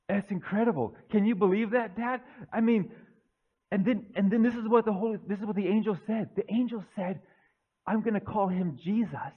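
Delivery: breathless, eager son phoning his dad with big news; excited young Joseph. Acted. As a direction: tumble the words out like a thrilled young man. Joseph excited again on phone.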